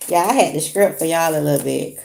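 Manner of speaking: ordinary talk, nothing marked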